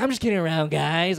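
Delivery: mock-jovial damage control; trying to save it. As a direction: imitate playing it off to the crowd. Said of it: "tries to save it".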